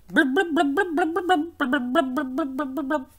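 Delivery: rhythmic blub-blub bubbling; bubbling brook. Acted.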